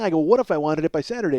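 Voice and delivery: ordinary talk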